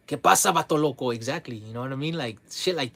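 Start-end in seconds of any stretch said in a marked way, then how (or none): 0.1-1.1 higher, louder Spanish-slang greeting; hammed-up vato talk. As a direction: lampoon how strangers address him. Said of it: "mocked stereotyped greeting".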